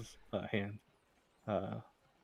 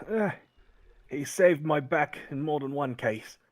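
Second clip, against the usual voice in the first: louder, higher fond gruffness; vouching for his friend; a hint of a loyal old companion; Sheldon's voice; 4 semitones higher, 5 dB louder than usual.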